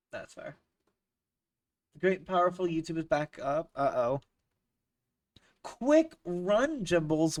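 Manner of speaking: usual speaking voice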